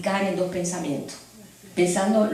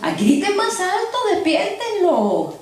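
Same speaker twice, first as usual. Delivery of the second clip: high, slow sneering jeer; mocking taunt; 10 semitones higher, slower than usual. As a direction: stretch it out to mock them. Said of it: "Hasta eso le dijo".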